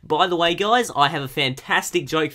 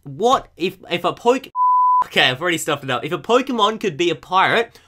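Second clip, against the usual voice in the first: ordinary talk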